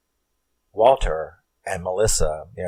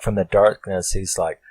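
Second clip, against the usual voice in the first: normal talking voice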